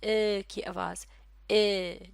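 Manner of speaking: ए held long and slow; long er vowel demo. Shown as a demonstration. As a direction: stretch the ए vowel for learners. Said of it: "वो sound क्या है".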